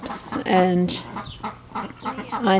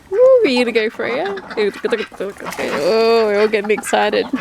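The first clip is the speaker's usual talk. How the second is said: excited cheering; 2 semitones higher than usual. Real, not acted. Cheering the ducks on.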